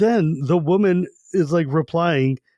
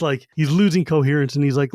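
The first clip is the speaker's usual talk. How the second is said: normal talking voice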